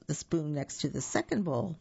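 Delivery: ordinary delivery, nothing marked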